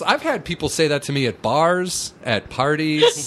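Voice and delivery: normal talking voice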